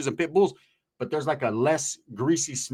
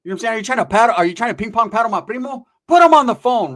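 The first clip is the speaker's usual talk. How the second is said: raised, high, loud indignation; retold angry outburst on the phone; angry and upset; 7 semitones higher, 9 dB louder than usual. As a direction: demand answers, getting heated. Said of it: "I'm getting angry and upset".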